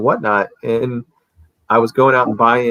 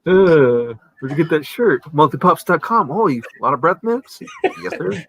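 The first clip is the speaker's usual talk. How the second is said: high put-on shop-banter voices; hammed-up high customer and seller voices; mock customer chat; 6 semitones higher than usual. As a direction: play both the curious customer and the proud seller. Acts customer dialogue.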